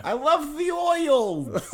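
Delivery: very high, slow, silly Baltimore voice; mock Baltimore accent. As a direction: badly fake a Baltimore accent, squeaked high. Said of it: "Everyone's got a lisp".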